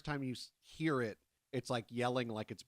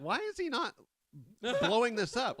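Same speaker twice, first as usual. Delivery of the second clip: incredulous quote; 10 semitones higher, 7 dB louder than usual. Retold or imitated. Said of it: "I'm like".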